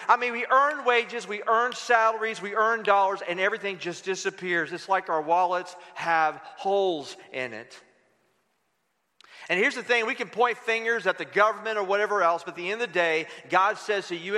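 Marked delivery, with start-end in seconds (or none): none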